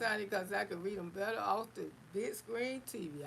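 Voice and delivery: normal talking voice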